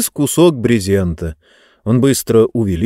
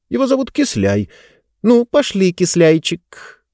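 cooing; 9 semitones higher than usual. Acted. Naming the pet, cooing.

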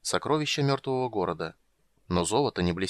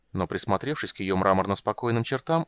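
plain reading voice

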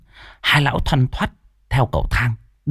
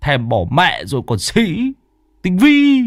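higher, mocking jab; higher-pitched old man ribbing his partner; teasing old exorcist; 8 semitones higher than usual. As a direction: needle your friend for being a hypocrite. Lão Tứ teases Tam.

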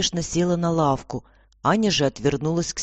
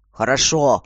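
curt, louder grumble; grunted; 2 semitones lower, 6 dB louder than usual. A narrator's reading. Commissioner to his assistant, grunted. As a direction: grunt a grudging acknowledgement. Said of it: "буркнул тот".

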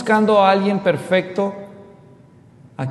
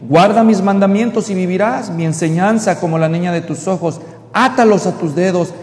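loud proclaiming sermon voice; full-voiced scripture reading; preaching scripture; 3 semitones higher, 8 dB louder than usual. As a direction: declaim the verse to the whole church.

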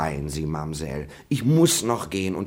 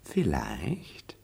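teasing whispered vielleicht; sly whispered reply; whispered echo; 6 dB quieter than usual. Acted. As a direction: breathe the word back at her. Teasing echo of her word.

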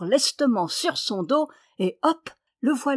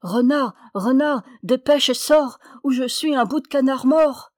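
crier fort et plus aigu, pressant; appel crié; 4 semitones higher, 5 dB louder than usual. A narrator's reading. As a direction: appeler à l'aide à pleine voix. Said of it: "Il s'écria". (le canard appelle son ami renard au secours, en criant)

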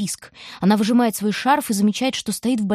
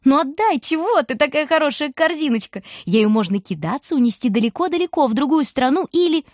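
high, wheedling storm wind; playful voice of the wind; teasing wind; 6 semitones higher than usual. A narrator's reading. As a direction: coax her into giving up the basket.

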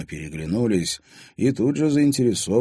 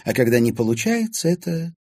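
put-on old lady describing a controlling man; knowing old lady; 9 semitones higher, 4 dB louder than usual. A narrator's reading. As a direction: explain his ways knowingly.

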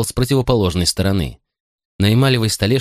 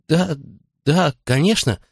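frantic whisper; 7 semitones higher than usual. Acted. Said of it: "судорожно прошептал Николас".

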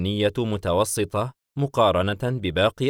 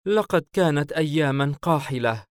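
lightly voiced child, higher and louder; a hint of a little boy; 6 semitones higher, 3 dB louder than usual. Child leaning on his companion's chest, voiced child.

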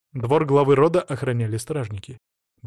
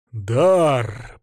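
growling; 4 dB louder than usual. A narrator's reading. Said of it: "прорычал Владимир".

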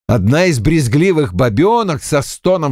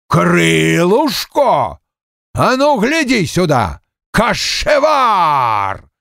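slow, drawn-out furious roar, pitched up; angry growl; 5 semitones higher, much slower than usual. A narrator's reading. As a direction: roar each word out long in anger. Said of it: "зарычал он". (рычит в гневе, протяжно)

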